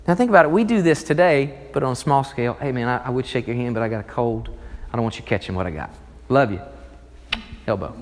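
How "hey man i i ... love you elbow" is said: lower, softer friendly apology; casual low-key aside. Acted. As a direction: mumble it like a friend with a cold. Friend with a cold.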